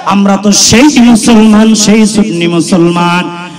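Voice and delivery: loud rousing preacher; loud fiery rallying cry; preaching at full force. Acted. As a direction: fire the crowd up. Rousing crowd.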